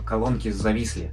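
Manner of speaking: normal talking voice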